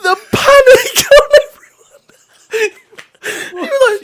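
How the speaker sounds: high, slow, wheezing laugh-talk; crying with laughter. Real, not acted. Telling a friend about the fake death, crying with laughter. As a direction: squeeze the words out through laughter.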